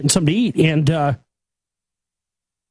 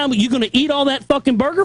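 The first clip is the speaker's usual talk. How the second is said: high, sneering repeat of the question; mocking angry echo; 5 semitones higher than usual. Mocking the worker's question back at him, angry sneer. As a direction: throw his words back at him.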